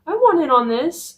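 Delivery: whiny cat demanding to come along; jealous cat voice. Retold or imitated. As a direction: play the cat begging to join his brother. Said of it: "He'd be like".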